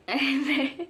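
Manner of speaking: laughing at her own story; giggling at her own anecdote; real laugh. Real, not acted. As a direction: break into a laugh.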